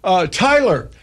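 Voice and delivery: mock-innocent announcement to a kid; wife calling son. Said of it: "and then she would say".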